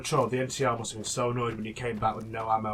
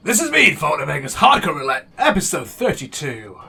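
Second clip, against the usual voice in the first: big announcer sign-off, much louder; loud outro sign-off; 2 semitones higher, 11 dB louder than usual. Acted. Host signing off to viewers, loud announcer voice. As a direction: boom out the episode close like a host.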